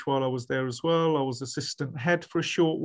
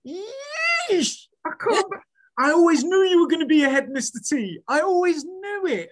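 much higher and louder, gushing with a laugh; excited former pupil; 14 semitones higher, 6 dB louder than usual. Retold or imitated. Former pupil spots his old teacher in Asda, high excited gush. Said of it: "He went".

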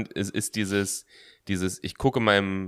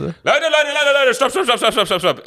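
laughing call to stop; 4 dB louder than usual. Real, not acted. Cuts in to stop the show.

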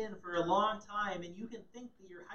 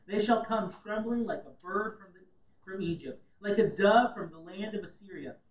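proclaiming; 3 semitones higher, 9 dB louder than usual. Acted. Reading scripture aloud.

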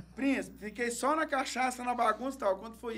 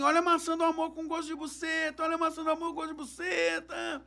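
vendor's call; 7 semitones higher than usual. Retold or imitated. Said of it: "Do nada ele começou assim".

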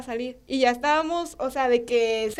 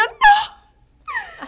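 very high, louder mock shriek with a laugh; retold scream half laughing; 9 dB louder than usual. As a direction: squeal it in playful fright. Frightened by dead fish.

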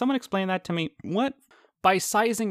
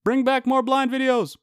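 much higher, louder mock demand; demanding commenter; 10 semitones higher, 5 dB louder than usual. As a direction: shout a fan's demand. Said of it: "comments like that".

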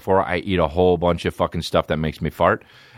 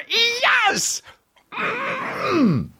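much higher falsetto squeal of joy; mock excited squeal. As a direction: shriek it like a giddy fan.

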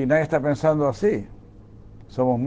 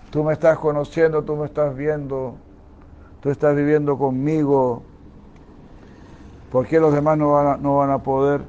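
raised, louder voice of God addressing the faithful; preaching at full force; 3 semitones higher, 4 dB louder than usual. Acted. Preacher voicing God to his congregation, raised and loud. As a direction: proclaim it like the divine speaking to you.

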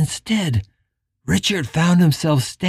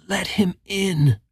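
hoarse whisper; 6 semitones higher, 5 dB quieter than usual. Acted. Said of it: "Tuilla whispered hoarsely".

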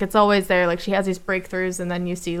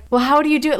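higher-pitched mock interviewer question; lightly mocking, raised pitch; 6 semitones higher, 3 dB louder, faster than usual. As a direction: imitate a clueless interviewer asking. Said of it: "stupid interviewers were like".

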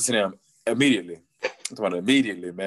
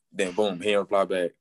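ordinary talk, nothing marked

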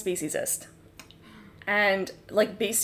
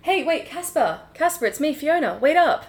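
higher-pitched hail; calling after someone; 5 semitones higher than usual. Pretend. Fiona hailing Casper as he limps in, calling out. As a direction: call out to make them wait.

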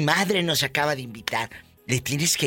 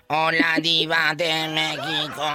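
robot lady voice. Retold or imitated. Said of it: "la voz como la del robot".

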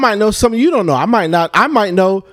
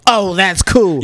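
mocking misread inflection; slower than usual. She calls him to read his text back, mocking misread inflection. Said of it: "They read it in their inflections".

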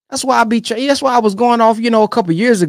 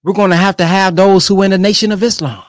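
ordinary talk, nothing marked

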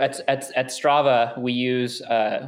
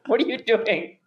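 baffled teammate; 8 semitones higher than usual. Retold or imitated. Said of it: "he just looked at me with".

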